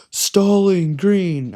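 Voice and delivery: slow, drawn-out high ref call; imitating the ref. Coach mimics ref announcing the stall call. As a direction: mimic the ref calling it out slowly. Ref's call acted in story.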